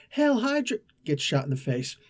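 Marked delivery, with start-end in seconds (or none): 0.1-0.8 mock scream. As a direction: imitate a minion crying out, pitch shooting way up. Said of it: "One of the guys screams out".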